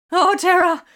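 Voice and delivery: high, loud cry of terror; terrified cry. A narrator's reading. Makar as flames lick in: loud, high terrified cry. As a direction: shriek it as the window explodes. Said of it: "Makar cried".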